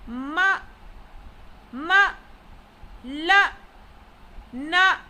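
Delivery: fourth-tone drill, "ma, ma, la, na"; falling tone. Shown as a demonstration. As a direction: fire off each syllable like a command. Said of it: "pronounced using a falling tone".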